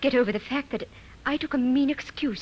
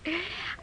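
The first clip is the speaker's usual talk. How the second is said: soft embarrassed laugh; 7 semitones higher, 8 dB quieter than usual. Acted.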